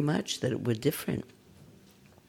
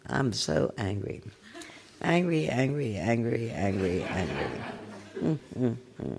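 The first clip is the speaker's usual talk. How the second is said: repeating angry; 2 semitones lower, 6 dB quieter, slower than usual. Shown as a demonstration. Teacher mocking a stuck meditator, fading angry chant. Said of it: "sitting with".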